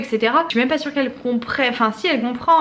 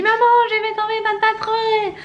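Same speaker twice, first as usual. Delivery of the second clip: imitating her little daughter; 12 semitones higher than usual. Mother quoting her little girl in the car, high childish voice. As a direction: squeak it like a small child.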